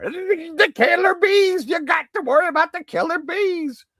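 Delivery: high shouted panic, slow; mocking alarmist. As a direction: shout the warning like a doom-monger. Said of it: "I'll stop mocking people".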